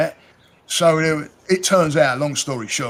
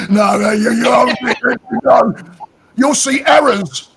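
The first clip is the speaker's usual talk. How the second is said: laughing loud refusal; 10 semitones higher, 8 dB louder than usual. Real, not acted.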